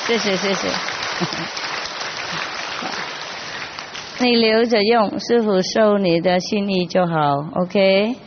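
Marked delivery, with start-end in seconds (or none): 0.7-4.1 soft breathy laugh. Real, not acted. Gift from a disciple.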